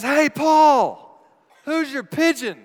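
high hollered taunt; loud, high call to someone far off; shout from afar. Retold or imitated. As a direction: holler it across the fairway. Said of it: "a shout billows in from afar".

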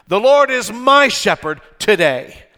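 loud, high declaration leaning on "my"; stress on MY. Shown as a demonstration. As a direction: proclaim it, hitting "my" hard. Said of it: "with the emphasis on my".

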